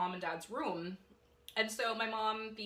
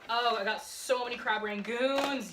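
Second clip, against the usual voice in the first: ordinary talk, nothing marked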